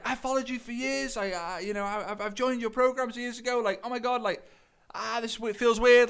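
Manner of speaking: high-pitched mock fan excitement; starstruck fan. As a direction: gush in a high, awed voice. Retold fan meeting his idol.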